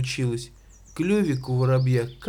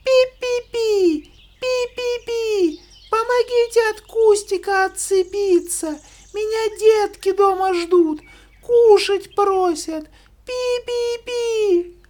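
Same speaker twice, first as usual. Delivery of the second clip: very high squeaky mouse voice; 6 dB louder than usual. Acted. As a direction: squeak like a tiny mouse in trouble. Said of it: "и запищала". (писклявый мышиный голосок)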